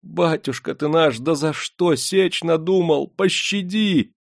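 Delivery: high, wailing plea; frightened, high-pitched entreaty; pleading for mercy. A narrator's reading. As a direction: beg the master, drawing out the last word. Servant begs master for mercy.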